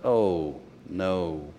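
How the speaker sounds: low, quiet mock dismay; retold dismay. Student caught joking by his professor, retold dismay. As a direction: sink into a soft, low "oh no".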